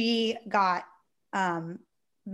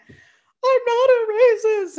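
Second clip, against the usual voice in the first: higher, louder and very slow, as if through tears; pleading, like you're about to break down; retold crying; 13 semitones higher, 8 dB louder, much slower than usual. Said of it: "And I'm, like, crying".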